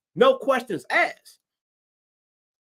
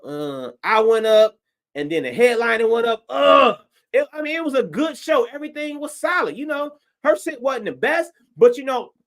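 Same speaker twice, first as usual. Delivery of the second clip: usual speaking voice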